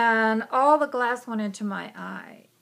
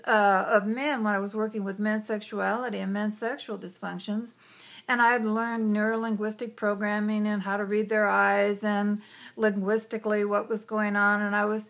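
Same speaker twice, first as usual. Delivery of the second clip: ordinary talk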